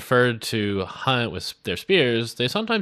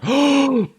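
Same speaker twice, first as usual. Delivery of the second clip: sharp gasp; 7 dB louder than usual. Real, not acted. Sudden sight in game.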